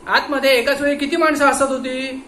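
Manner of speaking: loud, high interrogator's voice; stern questioning. Acted. Inspector grilling the watchman, loud and stern. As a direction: press him hard like a cop.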